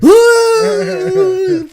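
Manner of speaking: high, loud terrified shriek; shrill freaked-out scream. Retold or imitated. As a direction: shriek like a spooked man. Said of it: "freaks out".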